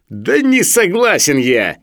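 high, slow, loud dissent; defiant objection. Acted. Sharikov objects to what he has read, defiantly. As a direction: dig in stubbornly.

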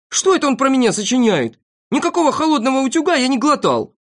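high, hurried indignant protest; Торопышка, shouting. A narrator's reading. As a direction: protest loudly at being slandered. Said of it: "закричал Торопышка". (возмущённо, на повышенных тонах)

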